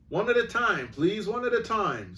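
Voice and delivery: high, firm calming order; harassed policeman. Acted. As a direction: hold the crowd back. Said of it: "said the policeman".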